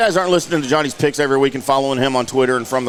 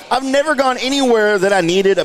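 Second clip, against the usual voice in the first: laughing; 8 semitones higher than usual. Real, not acted. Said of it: "laugh heard, high".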